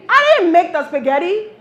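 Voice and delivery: loud, high-pitched mock defiance; defiant declaration. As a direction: boast it loudly to set up the joke. Fake defiant answer before reversal.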